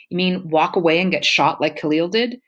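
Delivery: usual speaking voice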